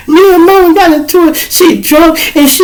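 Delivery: very high, loud, teary sobbing voice; imitating crying daughter. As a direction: blubber it out like a girl locked out at night.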